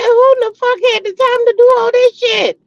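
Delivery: high, loud, disbelieving outburst; shocked outburst. Retold or imitated. To her viewers about a neighbor's lights, a high, loud shocked outburst. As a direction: throw up your hands at the sheer effort. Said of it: "I said".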